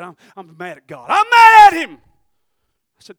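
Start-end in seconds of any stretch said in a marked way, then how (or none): 0.0-2.0 angry man quoted. Retold or imitated. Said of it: "he said".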